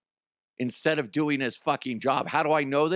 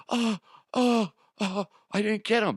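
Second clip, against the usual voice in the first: high, whimpering, scared confession; panicked intern; 8 semitones higher than usual. Retold or imitated. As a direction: whine it out like he's about to wet himself. He's about to piss himself.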